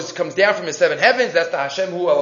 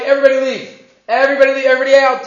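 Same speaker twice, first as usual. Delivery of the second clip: commanding shout; 6 semitones higher, 5 dB louder, much faster than usual. Acted. Yosef orders everyone out.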